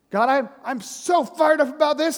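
fired-up protest. Retold or imitated. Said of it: "that's me all the time".

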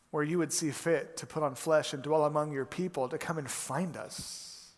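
soft, earnest congregational prayer. Real, not acted. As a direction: pray quietly over the church, unhurried. Pastor opens with prayer.

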